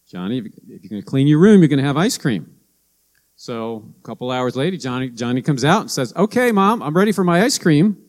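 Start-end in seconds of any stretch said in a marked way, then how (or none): 0.1-2.6 mom repeating herself. Retold or imitated. Mom checks back on Johnny and repeats the deal, fast and pushy. 6.2-8.0 put-on much higher, eager child voice; little boy. Johnny comes out asking Mom for his ice cream, eager child voice.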